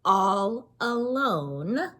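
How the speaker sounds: usual speaking voice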